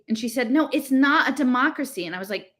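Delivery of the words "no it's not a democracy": higher, insistent rebuttal; insistent contradiction. Acted. Girl contradicts her friend in a political quarrel, insistent. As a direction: push back firmly in an argument. Acted escalating quarrel over democracy.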